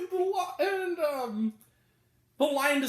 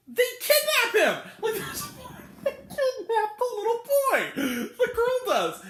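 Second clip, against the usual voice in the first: laughing disbelief; 10 semitones higher than usual. Real, not acted. Critic appalled they kidnap a boy, laughing high outburst. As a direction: exclaim it helplessly through laughter, high and drawn out.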